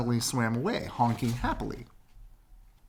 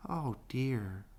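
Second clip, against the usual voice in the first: sad cry; 2 semitones higher, 7 dB quieter than usual. Acted. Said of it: "cried the ugly duckling".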